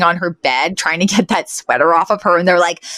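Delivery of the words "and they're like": ordinary delivery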